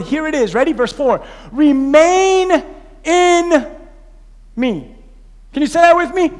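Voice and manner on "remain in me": "Remain in" stretched very slow and louder, then "me" drops; slow emphatic Remain. Acted. Pastor stressing the key verse to the church, slow and drawn out. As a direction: draw out "Remain in" like the heart of the sermon.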